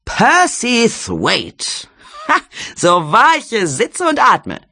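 high, slow, brash cry of delighted recognition; Jelly's loud exclamation. A narrator's reading. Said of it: "rief die Frau aus".

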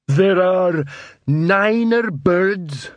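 slow, high, dim troll counting bricks. A narrator's reading.

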